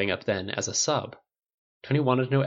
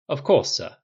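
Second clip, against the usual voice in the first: higher, louder butler-like reply; obliging assistant; 4 semitones higher, 5 dB louder than usual. Acted. Assistant accepting orders from the inventor, obliging. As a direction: answer as the dutiful assistant.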